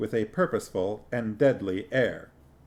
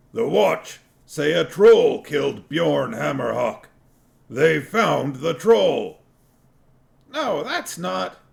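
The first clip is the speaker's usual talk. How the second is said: higher, louder dwarf accusing; a louder, higher dwarf voice arguing; 6 semitones higher, 7 dB louder, slower than usual. A narrator's reading. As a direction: insist like an angry dwarf. Dwarves arguing loudly.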